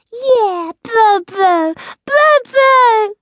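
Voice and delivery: very high, loud, excited squeal; piercingly high squeaky cheer; squeaky little creature. A narrator's reading. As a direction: squeak it out like an overjoyed toddler. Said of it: "squeaked Squeak".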